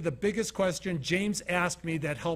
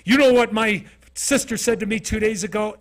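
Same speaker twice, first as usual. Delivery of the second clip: imitating an angry girl, much louder; loud, higher, indignant retold outburst; 4 semitones higher, 10 dB louder than usual. Girl complaining to him about her sister, angry. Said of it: "she starts getting angry".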